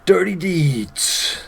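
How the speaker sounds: Dirty Deeds drawn out very slowly; slow dramatic reading. Real, not acted. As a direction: announce Dirty Deeds like a ring announcer. Reading move names slowly.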